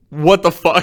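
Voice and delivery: loud, high, shocked "what the fuck"; mock stunned outcry; shocked shout. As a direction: yell it in disbelief like the whole room. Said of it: "everybody's like".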